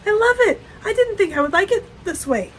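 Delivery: high, delighted gush with laughter; mock gushing. Friend retelling Sarah's sock news, laughing high gush. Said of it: "and she said".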